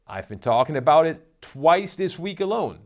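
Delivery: ordinary talk, nothing marked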